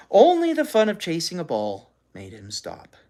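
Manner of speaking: ordinary delivery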